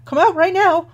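put-on high, loud, frightened young acolyte; a jumpy boy calling into the trees, high and loud; shaky scared voice. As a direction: challenge the dark while terrified. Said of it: "you hear a shaky voice say".

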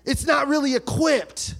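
loud, rising preacher's call with shouts; full-force preaching. Acted. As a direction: preach it out to the whole church.

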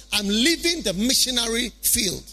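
ordinary talk, nothing marked

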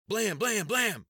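loud, sharp shouted bangs; shouted gunshot sounds. A narrator's reading. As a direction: bark out each shot. Gunshots voiced by narrator.

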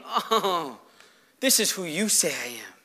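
soft, low, moved sigh; hushed, grateful wonder; quiet relief. Acted. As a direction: let the realization settle gently. Acts being moved by God's love.

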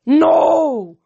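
high, loud raging bellow; furious bellow. A narrator's reading. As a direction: bellow it in rage. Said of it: "Kronos bellowed".

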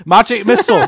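high, loud pretend battle call; mock spell cry. Player throws magic missiles at goblins, mock shouting. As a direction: cast it with a hammed-up shout.

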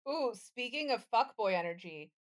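ordinary delivery

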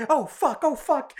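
high-pitched mock panic; freaking out. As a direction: imitate a group starting to panic. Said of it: "they're, like, freaking out".

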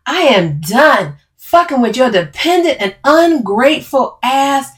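loud, high, heated tirade; angry swearing. A narrator's reading. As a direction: swear at them like you are through. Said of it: "Camille swears".

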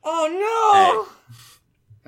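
very high, loud drawn-out cry; hammed-up anguished howl; dismayed wail. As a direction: wail as if the money's gone. Told it comes from paycheck.

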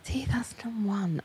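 whispering to herself; whispered. Real, not acted. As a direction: murmur it under your breath. Muttering to herself.

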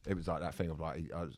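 ordinary talk, nothing marked

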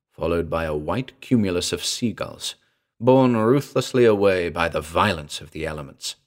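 usual speaking voice

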